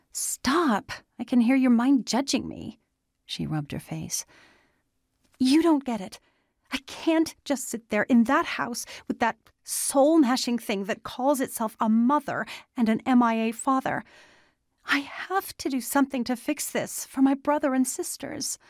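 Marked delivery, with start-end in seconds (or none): 0.1-2.8 high-pitched, agitated outburst; blurted out. A narrator's reading. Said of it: "Andy blurted". 5.4-18.6 high, agitated pleading; desperate outburst. A narrator's reading. Desperate girl arguing with friend.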